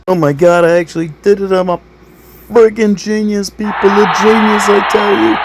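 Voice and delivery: high, very loud crowing; over-the-top high-pitched victory yell; triumphant outburst. Acted. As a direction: shout it like a self-declared genius. Boasting at passing robot test.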